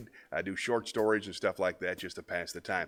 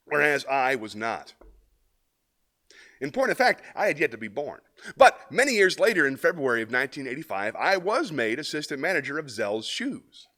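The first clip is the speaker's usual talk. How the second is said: louder, earnest comic monologue as a shoe salesman; 5 semitones higher, 6 dB louder than usual. Acted. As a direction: play the anonymous shoe seller with proud earnestness.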